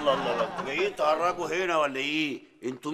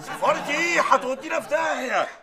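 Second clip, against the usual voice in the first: alarmed shout; 10 semitones higher, 4 dB louder than usual. Acted. Official panics at embassy idea.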